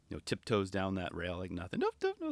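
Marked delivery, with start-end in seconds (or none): 1.8-2.3 tiny, very high, soft voice; dainty high-pitched little steps; little cat tiptoeing. Retold or imitated. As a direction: squeak it lightly like tiptoeing paws. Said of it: "like a little cat".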